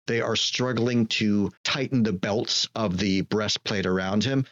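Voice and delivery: usual speaking voice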